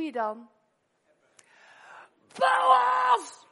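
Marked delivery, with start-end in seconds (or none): 2.4-3.5 calling a name up the stairs at the top of the lungs; a full, high yell; yelling for him from downstairs; shouting a name. Retold or imitated. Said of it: "ik schreeuw nog een keer en nog een keer".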